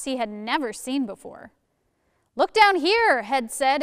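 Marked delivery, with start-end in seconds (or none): 2.3-3.2 high, loud call to someone above. A narrator's reading. Head calls to Arms hanging above, calling out. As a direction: call it out to get their attention.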